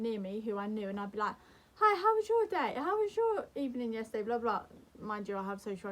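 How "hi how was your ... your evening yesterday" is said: high, loud chirpy small talk; over-eager greeting. Retold or imitated. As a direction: re-enact forced small talk, pitched way up. Re-enacts chatting to someone nearby.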